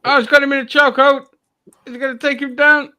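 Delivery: high, excited play-by-play; excited commentator. Pretend. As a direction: call the action like a ringside commentator. Mock commentary on the wrestling.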